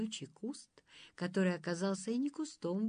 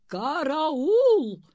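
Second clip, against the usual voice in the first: high, loud drawn-out call; demonstrative yell; 6 semitones higher, 9 dB louder than usual. A narrator's reading. Nanny shows how guards are called, yelling. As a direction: holler it to show how it's done.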